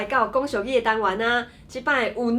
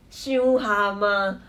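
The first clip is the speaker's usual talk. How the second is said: drawn-out exclamation; much slower than usual. Acted. Example skit, punchline exclamation.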